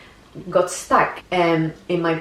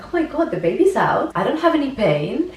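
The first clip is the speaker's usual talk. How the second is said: dazed relief; 6 semitones higher, faster than usual. Retold or imitated. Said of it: "I was like".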